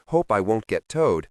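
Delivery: plain reading voice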